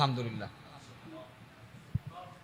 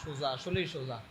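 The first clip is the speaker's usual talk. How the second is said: ordinary delivery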